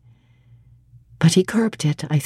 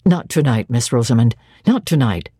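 lower, like an automaton; mechanical refusal; 7 semitones lower than usual. A narrator's reading. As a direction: refuse her, stiff and hollow. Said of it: "spoke almost like an automaton".

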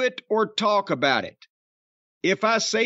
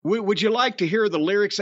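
normal talking voice

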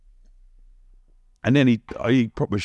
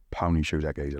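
low, quiet inner voice; private thought; 8 semitones lower, 7 dB quieter than usual. Retold or imitated. Sizing up rivals' shoes in the boardroom, low private thought. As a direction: drop it low, as if thinking to yourself. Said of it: "And I'm thinking".

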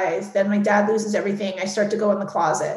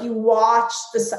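normal talking voice

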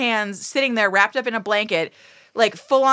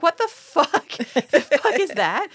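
retold disbelief; 5 semitones higher, slower than usual. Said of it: "And I was like".

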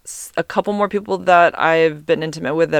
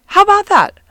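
much higher and louder, squealed out; emphatic exclaim; 9 dB louder than usual. Real, not acted. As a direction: throw it up high in delight. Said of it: "Why don't we get that reaction?".

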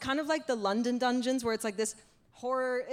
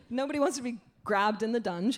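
normal talking voice

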